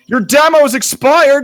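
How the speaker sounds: dramatic shout. Acted. Reading software pop-up dramatically.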